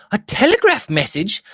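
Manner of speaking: a mild cry at the news; surprised cry. A narrator's reading. Lady Audley at breakfast sees a telegram, mild surprised cry. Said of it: "she cried".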